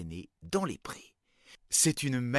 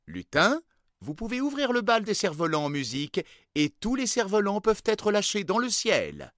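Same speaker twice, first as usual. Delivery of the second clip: king's announcement; 7 semitones higher, 6 dB louder than usual. A narrator's reading. King opens the festival.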